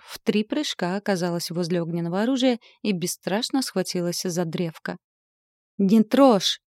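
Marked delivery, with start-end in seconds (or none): 5.8-6.6 loud, sharp prohibition; warning shout. A narrator's reading. Dragon warning her off the fiery scythe, shouting. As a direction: shout to stop her hand. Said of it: "закричал дракон".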